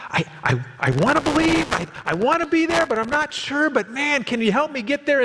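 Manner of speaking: high, earnest searching voice; pleading seeker. Retold or imitated. Pastor voicing an honest seeker, pleading high. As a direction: ask for help like an honest doubter. Acts honest struggling believer.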